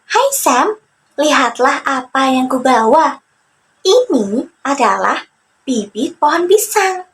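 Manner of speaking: put-on bouncy young trickster voice; Joni excited. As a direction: show off the seedling proudly. Joni shows his idea.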